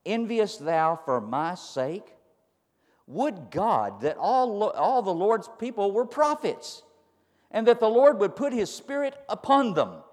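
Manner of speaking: slow, deliberate proclamation, building in pitch; emphatic scripture reading. Acted. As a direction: read the verse out weightily, rising toward the end.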